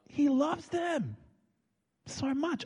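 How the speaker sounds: high, slow, impassioned declaration; overwhelmed with love. Real, not acted. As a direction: stretch it out with soaring feeling. Overwhelmed preaching of God's love.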